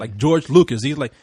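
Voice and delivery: usual speaking voice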